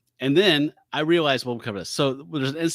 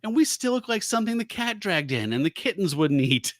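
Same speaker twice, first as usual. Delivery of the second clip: higher pleading complaint; 8 semitones higher than usual. Acted. Griffith pleading to the doctor, lightly higher. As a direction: plead with open hands. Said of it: "like, holding his hands out in supplication".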